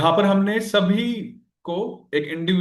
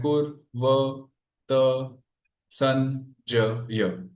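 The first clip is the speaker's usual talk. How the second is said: syllable by syllable; 3 semitones lower, much slower than usual. Shown as a demonstration. Teacher finishing the eight-syllable count, syllable by syllable. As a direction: separate syllables, evenly spaced with gaps. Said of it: "आठ ही हो गए".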